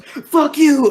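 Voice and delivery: very high, loud enraged yell; panicked thrall yelling at the goon; furious shout. Acted. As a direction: scream it as you swing. Thrall lashes out with mace.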